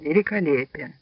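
murmured quietly; quiet remark. A narrator's reading. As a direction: say it softly, for a companion's ears only. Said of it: "проговорил тихо".

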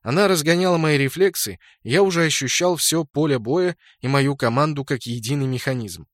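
ordinary delivery

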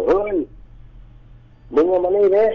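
high calling-out across the water; retold shout. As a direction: call him over from the far bank. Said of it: "ตะโกนว่า".

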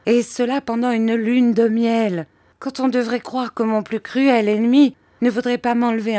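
high-pitched complaint; exasperated lament. A narrator's reading. Mother lamenting her spoiled honeymoon, high exasperation. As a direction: protest in a high, aggrieved voice. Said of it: "s'écria ma mère".

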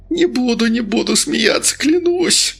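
high, frantic swearing; panicked vow. Acted. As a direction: blurt it out in terror. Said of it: "тут же отреагировал мажор".

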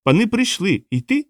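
high squeaky creature voice. A narrator's reading. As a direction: pipe it up as a tiny creature. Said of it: "сказав чорнолиций".

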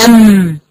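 أم said with lips pressed shut, humming the م; أم as a held nasal hum with sealed lips; closed-lip meem. Shown as a demonstration. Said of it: "هيصطدم المخرج ببعض".

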